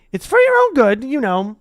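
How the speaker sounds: high, loud mock-pious; saintly voice. Peeves lecturing the students in mock piety. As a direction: pretend concern, all mischief. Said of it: "said Peeves in a saintly voice".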